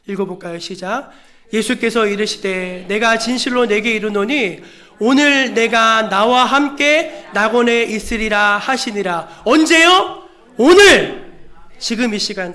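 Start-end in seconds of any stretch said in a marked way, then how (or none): 1.5-9.4 slow, loud, solemn recitation; stately pulpit Bible reading; scripture reading. Real, not acted. Reads scripture aloud to congregation. 9.4-11.3 high, very loud call to the congregation; forceful preacher's cry; rousing pulpit shout. Real, not acted. Preacher calls out the key word.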